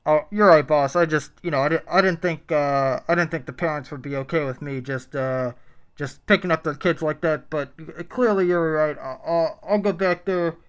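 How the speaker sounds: nervous fast food employee talking to boss; drive thru speaker. Acted. Plays employee Michael.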